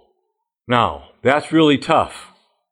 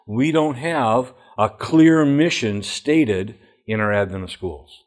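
normal talking voice

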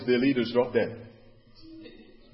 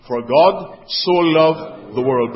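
slow, weighty verse with "so" leaned on; deliberate pauses around a heavy "so"; emphasis on "so"; 2 semitones higher, 6 dB louder, much slower than usual. Shown as a demonstration. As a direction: stretch "so" loudly in "For God so loved". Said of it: "How much is so?".

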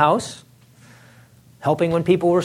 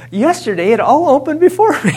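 laughing, higher, delighted reread of the line; aha moment; 9 semitones higher, 4 dB louder than usual. Retold or imitated. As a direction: relish it like a sudden discovery. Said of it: "this is an aha moment".

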